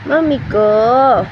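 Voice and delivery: slow, stretched call; slow drawn-out call. Real, not acted. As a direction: draw out each syllable.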